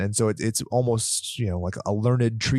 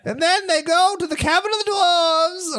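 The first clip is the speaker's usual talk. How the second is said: audiobook narrator; 3 dB louder than usual. Retold or imitated. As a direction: mimic the narrator's droning read, pitched way up. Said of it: "repeat of narrator quote".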